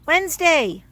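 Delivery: loud call across the coop; shouting for a hidden chick. Real, not acted. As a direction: call the chick's name loudly. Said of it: "Sorry, yelling at the camera".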